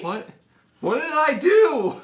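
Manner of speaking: loud, high outraged what did I do; indignant shout. Acted. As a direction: yell it in indignant protest. Joe shouting at accusation.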